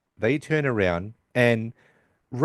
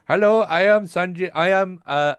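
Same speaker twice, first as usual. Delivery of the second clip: higher-pitched put-on Indian accent; mock Indian accent, pitched up; imitating Howard; 6 semitones higher than usual. Howard approaching a tall woman, put-on Indian accent. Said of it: "Must be that accent".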